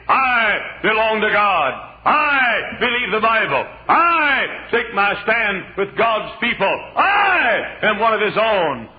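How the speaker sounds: loud, slow, shouted confession; bold declaration of faith. Retold or imitated. Preacher voicing Obadiah's missing stand in a slow shout. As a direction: proclaim belonging to God, slowly. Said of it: "stand up in the house of Ahab and say".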